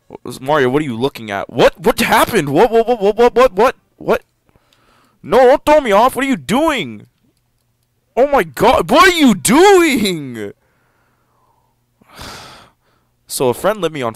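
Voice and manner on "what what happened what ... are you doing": shouted, high-pitched disbelief; startled outburst. Real, not acted. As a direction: freak out at the screen.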